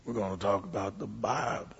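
put-on very low, quiet old-time sermon opening; imitated preacher's low rumble; grumbling preacher. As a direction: grumble it barely intelligibly. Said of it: "he kinda grumbles".

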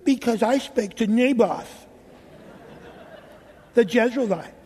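a pouting king complains, lightly voiced; whiny Ahab. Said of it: "I think it was like that".

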